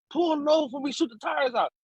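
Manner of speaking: retold police order. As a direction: call out a retold police warning. Police threaten in retold chase.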